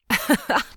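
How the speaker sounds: high scornful laugh; mocking laugh. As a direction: laugh him off. [name] laughs at him.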